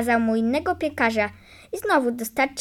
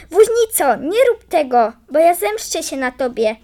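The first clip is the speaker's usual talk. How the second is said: high, louder warning cry; calling out; 4 semitones higher, 5 dB louder than usual. A narrator's reading. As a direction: call out a threat to the driver. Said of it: "A wróbel skoczy doń i woła". (wołanie z groźbą, wysoko i głośno)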